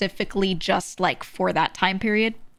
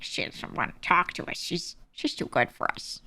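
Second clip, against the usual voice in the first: whispered, softer sulky complaint; mock sulky gossip; 2 semitones lower, 6 dB quieter than usual. Ghosted acquaintances complaining about her, whispered sulky. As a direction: mutter it like a hurt gossip.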